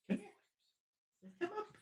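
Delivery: barely-voiced plea to the puppy to jump up; soft dog coaxing. Real, not acted. Calling his puppy up onto his lap, soft high coaxing.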